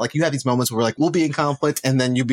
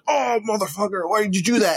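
mock road rage; 10 semitones higher than usual. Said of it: "cut off in traffic and you're just like".

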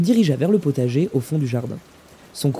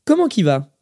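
higher, louder hearty hail; raised-voice hello; called-out greeting; 7 semitones higher, 5 dB louder than usual. A narrator's reading. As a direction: hail someone coming through the door. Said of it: "lança-t-il en entendant la porte".

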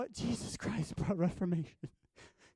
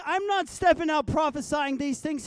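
ordinary delivery

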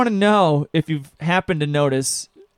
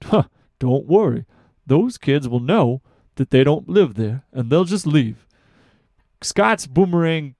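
cheesy infomercial pitch; hard sell ad; 6 semitones lower, slower than usual. Acted. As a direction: sell it like a late-night infomercial. Fake infomercial as Scott.

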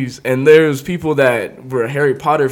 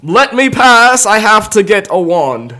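higher, louder in-character announcement; booming clerk voice, higher and louder; shop clerk staying in character; 6 semitones higher, 5 dB louder than usual. Retold or imitated. Shop clerk clearing the crowd, higher louder announcement. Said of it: "he had to stay in character".

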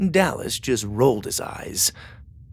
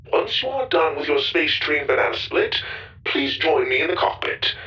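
higher, even robot voice; 6 semitones higher than usual. Acted. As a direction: speak like a polite ship robot. Goro robot over intercom.

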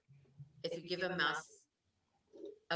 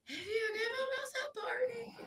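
put-on kid begging voice. Little boy begs teacher for his favorite book, whiny. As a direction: squeal it like an eager little boy. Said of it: "And he would just immediately".

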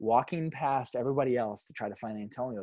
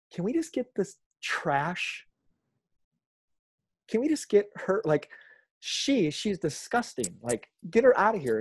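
disgusted Pharisee; 6 semitones higher than usual. Retold or imitated. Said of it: "I just picture the Pharisee like".

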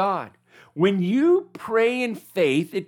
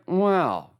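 flat unimpressed wow. Retold or imitated. Preacher imitating Jesus, unimpressed, with a flat wow. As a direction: say wow like you expected more. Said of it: "Or would he be like".

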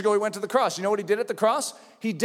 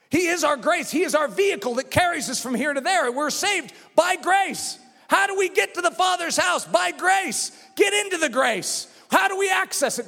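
higher, driving sermon delivery; full-force preaching; 7 semitones higher than usual. Real, not acted. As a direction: preach it with rising fervor.

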